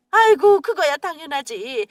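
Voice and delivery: mother thrilled at the prospect of money; delighted exclamation. Acted. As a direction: burst out in delight.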